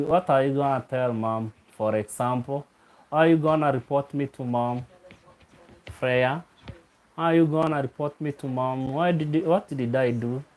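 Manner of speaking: ordinary talk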